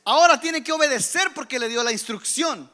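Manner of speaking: loud, driving preacher's voice; preaching. Acted. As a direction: preach it out to the church. Predica con fuerza. (predicación enérgica y fuerte)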